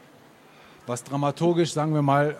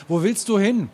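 high, raised call after someone; urgent higher-pitched call; 9 semitones higher, 6 dB louder than usual. A narrator's reading. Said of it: "Ich rufe".

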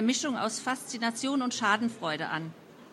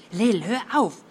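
loud, exasperated interruption; acted exasperation, punched out loud; snorting protest; 6 dB louder than usual. A narrator's reading. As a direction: cut her off with an impatient huff. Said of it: "Helly schnaubte".

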